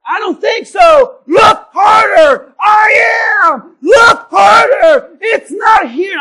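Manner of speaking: loud, high, drawn-out shouting; hammed-up marital shouting match; shouting argument. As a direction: yell back and forth over the missing cinnamon. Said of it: "I'm getting murdered".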